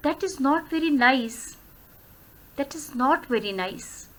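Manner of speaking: soft and slow, sinking down to "nice"; falling intonation. Shown as a demonstration. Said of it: "मेरा जो pitch of voice है". ("nice" तक धीमी, गिरती आवाज़)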